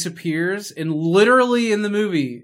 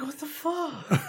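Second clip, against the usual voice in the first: baffled what the fuck; 10 semitones higher, 9 dB quieter, slower than usual. Retold or imitated. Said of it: "I was like".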